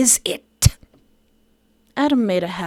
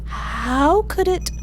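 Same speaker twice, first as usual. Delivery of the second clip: puzzled Adam; 8 semitones higher, much slower than usual. Acted. Adam's slow puzzled question.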